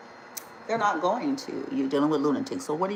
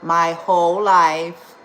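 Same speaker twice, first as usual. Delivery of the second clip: louder, slow and heavy; emphatic; 9 dB louder, slower than usual. Real, not acted. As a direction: lean hard into each word. Bitter emphatic repeat.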